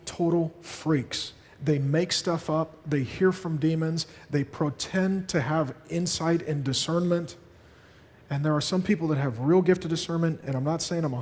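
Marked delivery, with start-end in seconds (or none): 0.1-7.4 slowed, dragging scorn; slow scornful list. Real, not acted. Pastor warning the congregation about hyper-spiritual people, slow and scornful. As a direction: spell out each fault slowly.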